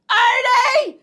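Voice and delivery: high, drawn-out yell for a sibling; mock-urgent high shout; imitated calling out. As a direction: holler the name through the house. Daughter calls sibling in.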